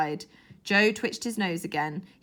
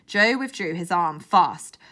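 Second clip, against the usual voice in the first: ordinary delivery, nothing marked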